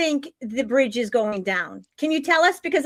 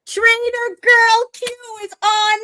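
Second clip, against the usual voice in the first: high, loud show-host excitement; thrilled introduction; 9 semitones higher, 5 dB louder than usual. Real, not acted. Host welcoming a surprise guest on air, thrilled. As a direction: announce the guest like a star. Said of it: "I'm so excited".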